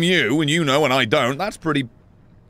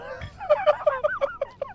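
long high peal of laughter; 5 dB quieter than usual. Real, not acted. Gamer delighted by the game, laughing long. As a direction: laugh helplessly.

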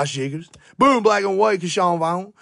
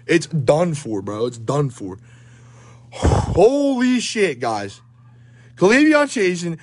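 usual speaking voice

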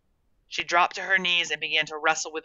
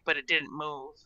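ordinary delivery, nothing marked

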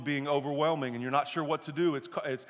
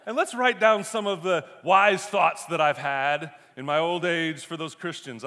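hammed-up British gentleman, higher and louder; British accent; 4 semitones higher, 8 dB louder than usual. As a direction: call grandly to the butler in a mock British accent. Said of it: "why Paul is British".

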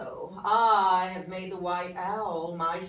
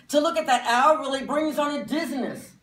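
exclaiming; 7 semitones higher, 5 dB louder, faster than usual. Acted. Critic exclaims at owl.

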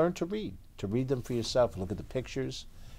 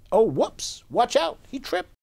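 much higher, playful whoops; mock alarm; 4 dB louder, slower than usual. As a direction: squeal it out as he falls over. Narrator reacts to T-Rex tripping.

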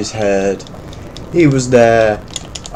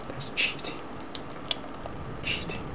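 whispered complaint; 19 dB quieter than usual. Real, not acted. Muttering complaint.

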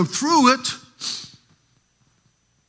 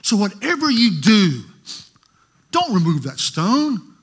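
animated preaching retelling; 4 dB louder than usual. Real, not acted. Preacher dramatizing Martha warning Jesus at the tomb, loud and animated. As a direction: act out Jesus and Martha for the church.

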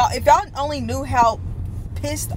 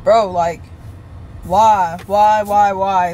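louder, exasperated repeated why; angry why; 3 semitones lower, 4 dB louder, much slower than usual. Real, not acted. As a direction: demand an answer, fed up and louder each time. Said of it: "I'm not happy".

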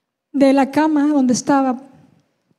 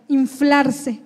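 ordinary talk, nothing marked

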